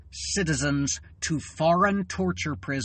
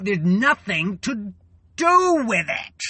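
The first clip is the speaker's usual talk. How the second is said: rising to a loud, much higher cry on do with it; exploding in rage; 5 semitones higher, 4 dB louder than usual. Real, not acted. Venting to her viewers about helpless rage, loud outburst. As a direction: let the frustration burst out.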